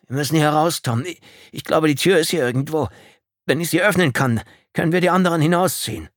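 high, loud, pressing urgency; suppressed panic. A narrator's reading. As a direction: urge him to get out fast. Fighting panic, urging escape from wreck.

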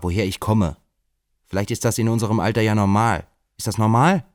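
defensive outburst building to a loud question. Acted. Robert erregt über seine Freundin. (aufgebracht und laut, sich immer mehr in Rage redend)

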